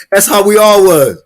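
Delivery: excited. Real, not acted. Host telling viewers how they all reacted, excited. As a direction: burst out remembering the fun. Recalling hearing Sarge, excited.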